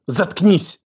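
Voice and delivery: sharp, high angry order; barking. A narrator's reading. Елена silences his protest, barking. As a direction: bark it to cut him off. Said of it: "рявкнула она".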